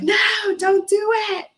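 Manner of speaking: high, desperate pleading no; frantic movie plea. Retold or imitated. Movie character on the phone, a high frantic plea. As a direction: beg them to stop, pitch way up. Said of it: "those of you that have seen Sarah Marsh".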